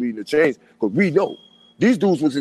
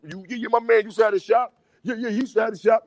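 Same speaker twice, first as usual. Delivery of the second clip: higher, eager show-off voice, lightly mocked; mock bragging brother; 7 semitones higher than usual. Podcast host mocking brothers who brag about his lost shop, higher voice. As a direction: mimic a guy bragging about your old shop. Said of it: "they bragging about it".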